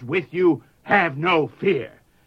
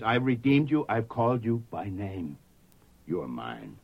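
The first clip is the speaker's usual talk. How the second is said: tender voice of God; 4 semitones lower, 6 dB quieter than usual. Acted. Preacher voicing God's tender words.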